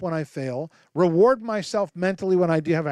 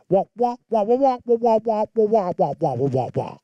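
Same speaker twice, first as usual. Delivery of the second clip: higher, muffled trombone-like wah-wah babble; Charlie Brown teacher voice; 4 semitones higher than usual. Retold or imitated. The father hears the son's speech as Charlie Brown wah-wah. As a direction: make the son's words into meaningless wah-wah noise. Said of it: "here's what the father heard when the son said that".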